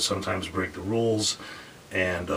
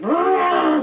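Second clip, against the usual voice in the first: mock elephant scream; 8 dB louder than usual. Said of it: "And they're like".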